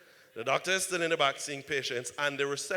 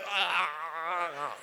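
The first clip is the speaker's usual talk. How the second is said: pained groan. Retold or imitated. In burning pain.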